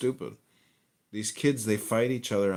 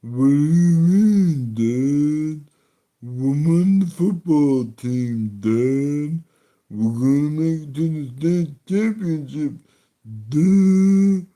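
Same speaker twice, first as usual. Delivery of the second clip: loud, slow dopey football son voice, pitched higher; dumb jock son; 7 semitones higher, 10 dB louder, slower than usual. Acted. Comic voicing the football son to his dad, loud dopey drawl. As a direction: ham up a brain-damaged jock, loud and dragged out.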